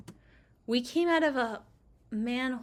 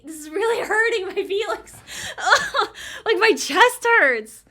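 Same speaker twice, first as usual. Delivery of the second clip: laughing through mock heartbreak, pitch jumping high; pained laughing; 8 semitones higher, 8 dB louder than usual. Real, not acted. Player laughing in pain at a sad game scene, high pained laugh. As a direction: cackle as the sad scene hurts. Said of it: "doing psychic damage to me".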